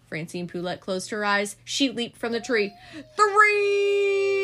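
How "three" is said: high shouted leap cry. A narrator's reading. Francine shouting as she leaps onto the table. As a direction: yell it as she jumps from the tree.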